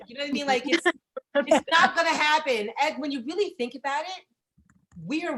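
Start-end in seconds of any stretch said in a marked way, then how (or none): none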